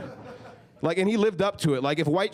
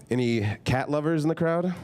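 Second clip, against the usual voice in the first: usual speaking voice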